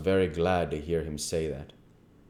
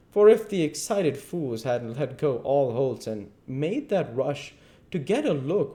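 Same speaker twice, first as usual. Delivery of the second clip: higher-pitched, emphatic lecture; scolding duke; 8 semitones higher, 5 dB louder than usual. Acted. Duke blaming the king for the failed scheme, scolding sarcasm. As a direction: lay out his blunders with sarcastic relish.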